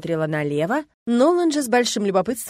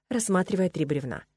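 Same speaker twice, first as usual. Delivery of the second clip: ordinary delivery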